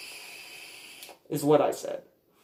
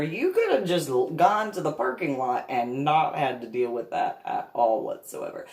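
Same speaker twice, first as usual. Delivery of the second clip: normal talking voice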